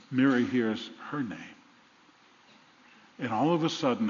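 ordinary delivery

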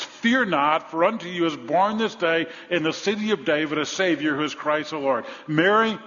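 fiery Easter sermon; full-force preaching. Real, not acted. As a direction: preach it with mounting fervor.

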